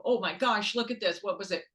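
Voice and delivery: usual speaking voice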